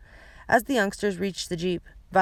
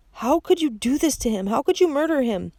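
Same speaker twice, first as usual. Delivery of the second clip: higher, rising accusing cry; anguished accusation; 7 semitones higher, 3 dB louder than usual. A narrator's reading. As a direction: demand an answer from the killer. Klaus accusing his uncle's killer.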